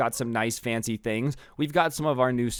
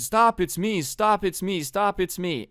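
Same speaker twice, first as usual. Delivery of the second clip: higher-pitched retold plea, repeated urgently; mock pleading; 7 semitones higher, slower than usual. Brother pushed against the ground, acted pleading. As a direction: imitate someone pinned and protesting.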